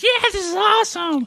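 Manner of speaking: very high, hammed-up excited squeal; mock elated cheer. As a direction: pop out with a pretend gleeful cry. Acted jumping out Monday morning.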